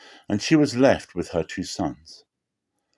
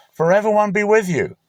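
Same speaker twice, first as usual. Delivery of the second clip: higher, louder hearty blessing; Boaz greeting; 12 semitones higher, 5 dB louder than usual. Acted. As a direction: call the blessing across the field.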